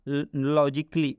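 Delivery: लॉजिकली said slowly without the a, as lojikli; careful correct pronunciation. Shown as a demonstration. Teacher slowly modelling लॉजिकली for students. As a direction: sound out लॉजिकली slowly, dropping the a. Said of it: "क्या पढ़ेंगे?".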